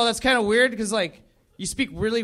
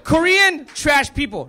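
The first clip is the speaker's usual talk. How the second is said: loud and higher mock outburst; coworker's rant; 6 semitones higher, 6 dB louder, slower than usual. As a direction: bark out the coworker's insult. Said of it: "He's like".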